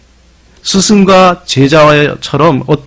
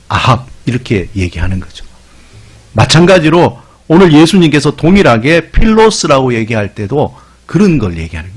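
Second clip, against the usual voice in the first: normal talking voice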